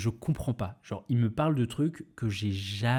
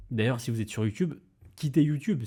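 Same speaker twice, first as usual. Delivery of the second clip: usual speaking voice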